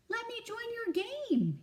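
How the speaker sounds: squeaky plea to join in; small squirrel begging the bigger animals to play; shouting squirrel. Acted. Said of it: "he shouts".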